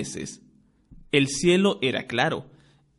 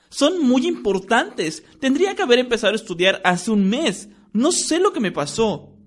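put-on higher, fussy and worried girl's voice; a top student panicking about exams; lightly voiced girl, agitated and rushed; anxious schoolgirl; 8 semitones higher, 3 dB louder than usual. A narrator's reading.

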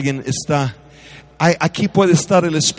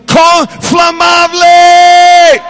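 slow, stretched, shouted prayer; preaching at full force; 10 dB louder, much slower than usual. Acted. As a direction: cry out the final word to heaven. (clamor de predicador, gritado y alargado)

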